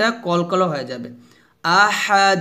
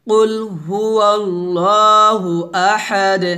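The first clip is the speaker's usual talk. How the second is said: Quran recitation demo; 3 dB louder, slower than usual. Shown as a demonstration. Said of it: "আবার পড়ি".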